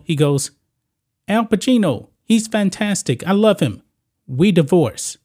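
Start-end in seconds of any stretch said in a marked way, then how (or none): 1.3-5.2 put-on higher, delighted Italian accent; gushing in an Italian accent, starstruck. Italian husband hears the actor's name, delighted Italian accent. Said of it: "she does this in an Italian accent".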